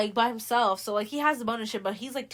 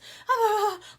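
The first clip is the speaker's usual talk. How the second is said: crazy laugh; 12 semitones higher, 6 dB louder than usual. Retold or imitated. Andrew gone crazy, laughing.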